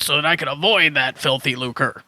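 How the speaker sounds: ordinary talk, nothing marked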